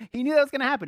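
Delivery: chuckling as you say it; laughing. Real, not acted. Preacher amused that God knew, laughing. Laughing at his own point.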